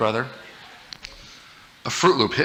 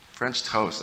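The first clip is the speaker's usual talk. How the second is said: disgust; 3 semitones lower, 8 dB quieter than usual. Real, not acted. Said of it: "bread dunked in mucus".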